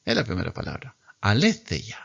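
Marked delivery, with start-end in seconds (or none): 1.2-2.0 Aletheia with a falling end; teacher says the word for the class to echo; model reading. Shown as a demonstration. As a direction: say Aletheia clearly for repeating. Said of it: "Repita siempre conmigo".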